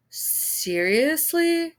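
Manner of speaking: slow stretched stunned seriously; drawn-out disbelief. A narrator's reading. Anchorman stunned by a father mocking his son, slow disbelief. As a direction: let the disbelief hang.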